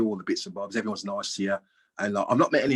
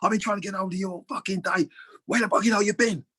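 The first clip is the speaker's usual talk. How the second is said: high-pitched furious ranting; imitated angry chairman; 11 semitones higher than usual. Chairman bawling at his player down the phone, mock fury. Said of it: "I apologise about my language".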